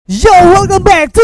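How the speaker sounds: slow, loud drawn-out intro; announcer voice. Acted. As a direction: call it out like a show opener. Segment opener. (suara pembawa acara lambat dan keras)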